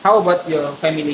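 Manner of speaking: ordinary talk, nothing marked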